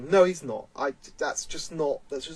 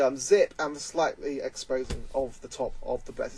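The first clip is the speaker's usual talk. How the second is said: usual speaking voice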